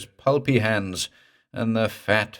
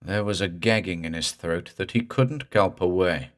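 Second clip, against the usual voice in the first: ordinary delivery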